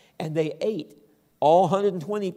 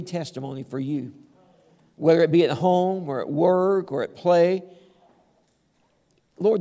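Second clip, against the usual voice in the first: low, earnest pastoral prayer; 3 semitones lower than usual. Real, not acted. Preacher prays over the congregation, low and earnest.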